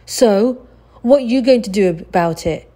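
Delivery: bully daring Oliver, loud jeer. A narrator's reading. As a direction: goad him to fight. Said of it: "Noah jeered".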